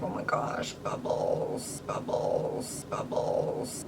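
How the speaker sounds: hushed, wondering whisper; awed child whisper. Retold or imitated. Imitating her younger self finding bubbles, an awed whisper. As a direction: breathe it out like an amazed kid. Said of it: "And I was like".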